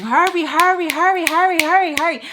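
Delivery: loud, high, rapid-repeat nagging; snapping. Retold or imitated. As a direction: hammer it out in high, loud bursts. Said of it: "Like, snapping".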